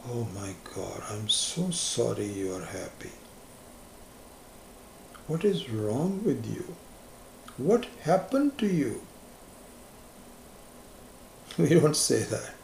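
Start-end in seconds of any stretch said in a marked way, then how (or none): 0.1-9.0 mock pity. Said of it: "We don't go around saying".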